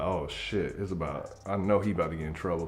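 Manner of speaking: low, soft aside to yourself; muttered dread. Acted. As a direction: mutter it under your breath, hurrying at the end. Watching friend about to get caught.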